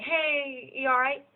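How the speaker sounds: British-style "you all right?" greeting. Retold or imitated. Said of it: "I'm not putting on an accent".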